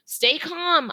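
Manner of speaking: high called-out reassurance; calling out. A narrator's reading. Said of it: "I call".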